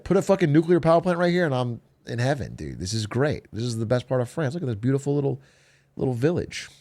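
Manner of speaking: normal talking voice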